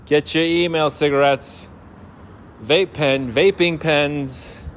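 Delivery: high carrying call of a ballpark vendor; stadium vendor hawking. Acted. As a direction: hawk snacks up and down the stands. Vendor calling out wares.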